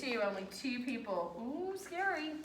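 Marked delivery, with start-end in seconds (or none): 1.4-2.3 higher, softer pretend fright; a soft, teasing mock "ooh"; mock scared. Teacher teases about few answers.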